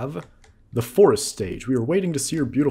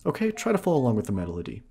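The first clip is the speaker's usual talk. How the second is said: fast, higher eager invitation; Saria voice; 4 semitones higher than usual. Acted. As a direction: invite him to play, quick and eager. Voicing Saria.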